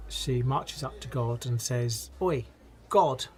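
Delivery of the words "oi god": brusque British "oi" to get attention; indignant British heckle; British accent. Acted. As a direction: call out in a blunt British accent. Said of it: "definitely from Britain".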